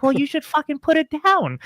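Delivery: high-pitched, upbeat customer service voice; Aaron voice. Acted. Staffer taking an angry customer's call, put-on customer service voice. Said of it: "put the Aaron voice on".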